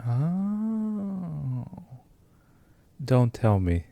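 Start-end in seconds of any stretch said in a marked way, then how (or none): none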